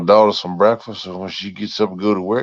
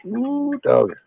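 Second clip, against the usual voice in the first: excited ooh. Real, not acted. Craving peanut butter popcorn.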